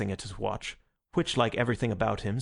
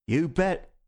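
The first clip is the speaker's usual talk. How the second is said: higher-pitched cockney retort; 7 semitones higher, 4 dB louder than usual. A narrator's reading. Unmasked Gogol answers Sunday, cockney. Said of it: "somewhat cockney voice".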